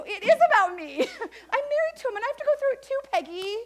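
imitating her own huffy reply, half laughing; mock indignant retort. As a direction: snap back at the sister-in-law with a laugh. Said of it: "I wanted to go".

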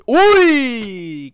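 high, loud, drawn-out yelp; long startled cry. Real, not acted. Gamer is almost knocked off, drawn-out yelp. Nearly pushed off.

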